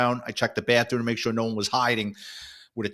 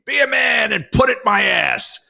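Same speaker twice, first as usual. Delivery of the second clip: imitated woman's yell, high and loud; woman screaming; 11 semitones higher, 7 dB louder than usual. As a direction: belt it out as a screaming woman heard through a door. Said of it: "a woman's voice scream".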